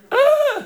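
high, held "ah!" of surprise; sudden high yelp; startled cry. Acted. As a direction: cry out as if caught off guard. Startled on walking in on someone.

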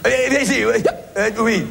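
comic colleague calling out, hammed up; imitating Alain. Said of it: "j'adore l'imiter".

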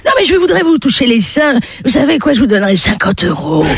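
put-on insistent old man. Vieux insiste.

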